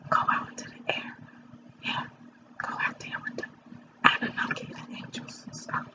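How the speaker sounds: soft unvoiced whisper. Real, not acted. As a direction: breathe the words out, barely heard. Dreamy aside about the air.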